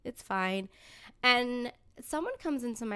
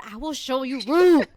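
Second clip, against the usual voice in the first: laughing threat; 6 semitones higher, 11 dB louder than usual. Real, not acted. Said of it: "want me to be rude".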